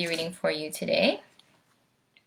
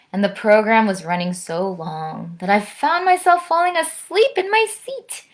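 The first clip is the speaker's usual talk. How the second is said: bouncy rhyming storytelling; animated storytime voice with pitch swoops; picture-book read-aloud; 5 dB louder than usual. A narrator's reading. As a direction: tell the verse expressively to children.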